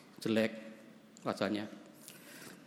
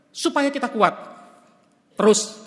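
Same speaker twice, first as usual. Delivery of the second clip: exhorting; 10 semitones higher, 11 dB louder than usual. Acted. Preacher exhorting congregation.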